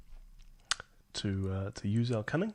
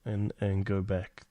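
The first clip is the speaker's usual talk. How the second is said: ordinary talk, nothing marked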